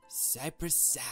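ordinary delivery

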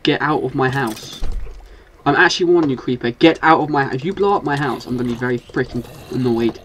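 loud, rising angry warning; shouting at creeper. Real, not acted. Player yelling at a creeper near his house, angry. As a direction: warn it off loudly, getting heated.

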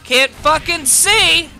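drawn-out, rising-temper complaint; exasperated outburst. Real, not acted. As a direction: let the frustration burst out slowly. Repeats complaint, then louder.

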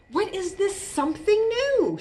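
high-pitched curious question; wondering chipmunk. A narrator's reading. Chipmunk looking for the something new, wondering. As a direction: voice a puzzled little chipmunk.

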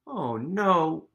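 ordinary delivery

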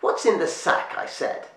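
a crone losing patience and repeating her demand; insistent witch. A narrator's reading. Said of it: "The old woman had grabbed".

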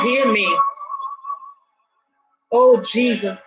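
normal talking voice

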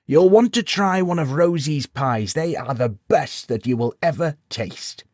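put-on high, proud old villager; old codger voice. A narrator's reading. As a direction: boast about the pies. Old codger recommending pies.

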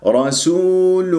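رسول drawn out slowly, full damma then nasal ghunna; correct recitation. Shown as a demonstration. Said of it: "فيجب أن تجيب الضمة ثم تدخل في الغنة".

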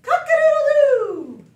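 very high, loud rooster call; rooster crow. Pretend. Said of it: "like a rooster".

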